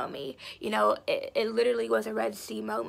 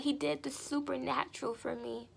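usual speaking voice

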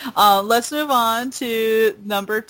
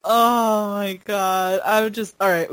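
usual speaking voice

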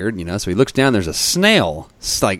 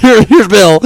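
high, loud, laughing hard; 9 dB louder than usual. Real, not acted. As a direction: barely get it out through real laughter. Cracks up laughing introducing Bill.

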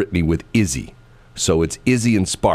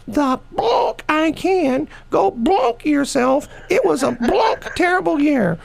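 put-on much higher, slow, feisty old-lady voice; cranky Granny Shelley; slower than usual. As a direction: snap back at the grandkids in a sassy high granny voice.

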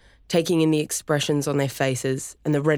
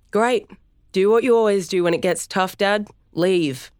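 louder, higher scornful jab; bitter taunt; 6 semitones higher, 4 dB louder than usual. Acted. Son mocking his father for leaving, bitter and louder. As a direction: sneer it as he walks out. Storm taunting departing dad.

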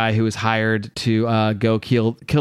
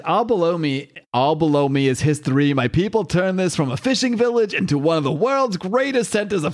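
put-on British accent; 5 semitones higher than usual. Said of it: "he's British".

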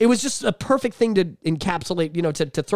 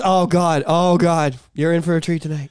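normal talking voice

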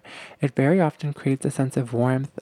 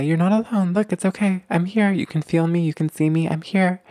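higher-pitched reassuring parent voice; soothing mom; 5 semitones higher than usual. Acted. Parent holding and rocking the baby, soothing. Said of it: "you hold them, you rock them, and you say".

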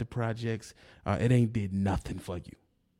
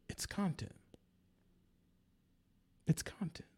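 whispered, much softer mock excuse; 6 semitones higher, 11 dB quieter than usual. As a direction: breathe it out like a sneer. Mocking the rival's excuse.

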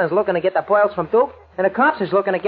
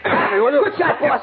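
laughing; 8 semitones higher, 4 dB louder, much slower than usual. Acted. Cheering the boss's punch.

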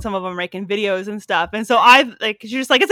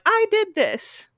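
slow, high, proud I did this; much higher and slow, pleased with yourself; proud self-quote; 12 semitones higher, much slower than usual. Retold or imitated. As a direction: savor the accomplishment.

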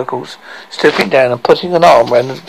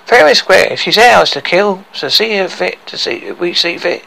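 defiant dwarf Tricky; 5 semitones higher than usual. Acted. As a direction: argue your claim as a cocky dwarf.

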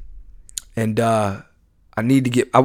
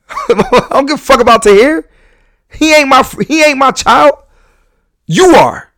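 imitating mom; 10 semitones higher, 4 dB louder than usual. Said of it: "she's like".

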